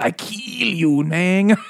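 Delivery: high, stretched-out mock Mexican accent; bad Mexican accent. Bird threatening to kill, bad Mexican accent. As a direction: ham up a cartoonish Mexican accent, pitched high. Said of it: "like Mexican bad accent".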